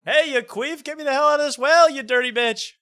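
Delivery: very high, loud mock shriek; screaming lady. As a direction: shriek from the bottom of a well. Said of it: "you would act like that".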